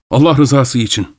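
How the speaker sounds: usual speaking voice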